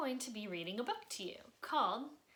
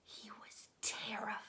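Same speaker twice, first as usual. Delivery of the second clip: whispered "he was terrified"; hushed spooky whisper; scared whisper; 4 dB quieter than usual. A narrator's reading. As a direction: breathe it like a frightened secret. Scared child in the dark.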